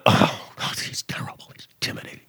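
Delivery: whispered mock horror; mock hushed dread. Host mocks CNN reporting on Trump in a hushed whisper. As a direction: breathe it out like a scared newsreader. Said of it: "C N N reads it like".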